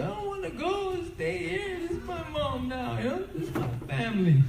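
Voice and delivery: put-on boy pleading to stay with his foster mom, high and tearful; crying little boy. Child crying not to leave.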